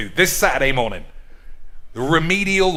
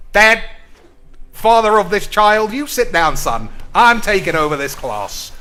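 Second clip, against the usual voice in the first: loud, bossy takeover of the class; hammed-up alpha announcing himself; mock commanding shout; 7 semitones higher, 7 dB louder than usual. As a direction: boom it out like you own the gym. Confronting a dad, taking over.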